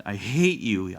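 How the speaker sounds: put-on loud child's howl; tearful yell of fury. A narrator's reading. Boy howls at the woman dragging him away, howling. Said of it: "he howled".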